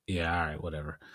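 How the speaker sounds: low, soft brush-off; dismissive. Retold or imitated. Storyteller brushes off the waving man, quietly dismissive. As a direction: drop it down and shrug him off.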